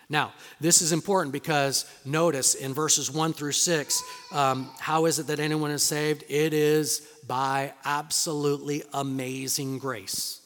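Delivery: loud, driving pulpit delivery; hammering home salvation by grace; full-force preaching. Acted.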